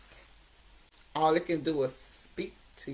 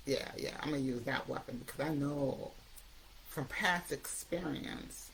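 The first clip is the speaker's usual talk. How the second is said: scheming addictive voice; 4 semitones lower, 8 dB quieter than usual. Retold or imitated. Addiction voice plotting to win the addict back, low scheming. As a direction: lower and softer, plotting.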